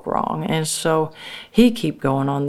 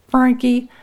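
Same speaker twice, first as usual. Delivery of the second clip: louder, higher snap of the name; sharp call of the name; 9 semitones higher, 6 dB louder than usual. Acted. Boss Man cutting Frankie off with a sharp, higher, louder call. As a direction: call him out sharply to cut him off.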